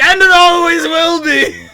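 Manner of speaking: hammed-up villain roar, very high and loud; slow, booming cartoon boast; Glomgold. Streamer acting out favorite Glomgold line to Louie, hammed-up shout. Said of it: "And he's like".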